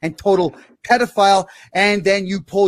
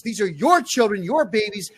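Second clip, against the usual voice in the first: usual speaking voice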